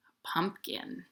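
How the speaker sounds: whispered reveal; hushed pumpkin reveal. Real, not acted. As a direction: whisper the answer like a secret. Answers own quiz question.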